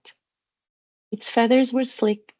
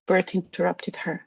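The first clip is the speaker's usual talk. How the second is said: ordinary delivery, nothing marked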